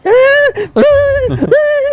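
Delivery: high yelping imitation of the stung dog; dog yelp laugh. Telling how the stung dog cried, high yelping laugh. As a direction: squeal it up like a hurt dog.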